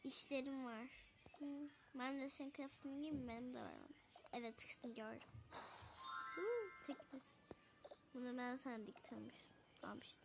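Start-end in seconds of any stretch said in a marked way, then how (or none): 2.2-5.3 whisper. Acted. Whispering so daughter won't wake. 8.1-10.2 whisper. Acted. Whispering about sleeping daughter.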